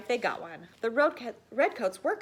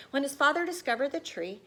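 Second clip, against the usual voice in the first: usual speaking voice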